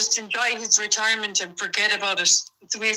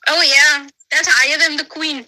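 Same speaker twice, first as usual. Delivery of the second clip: excited; 3 semitones higher, 7 dB louder than usual. Real, not acted. Excited answer about empress.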